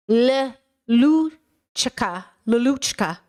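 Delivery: L, luchka dragged out very slowly; slow L drill. Shown as a demonstration. Vlogger practising the hard Croatian L, slow. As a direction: stretch the L in luchka. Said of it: "make fun of me for my L sound".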